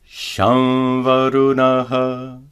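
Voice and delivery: slow Shamvarunaha with a nasalized va; Sanskrit example. Shown as a demonstration. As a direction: chant Shamvarunaha slowly, va through the nose.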